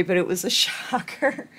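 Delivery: laughing through the last word. Real, not acted. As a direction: let a laugh break in. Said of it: "I'm laughing about it now".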